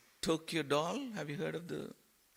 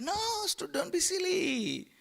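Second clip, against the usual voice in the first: much higher, dismissive temptress voice; scolding Maya; 9 semitones higher than usual. Acted. Maya dismissing Brindavan and luring to Bondi, high mocking squeal. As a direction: brush him off in a high squeal, then lure.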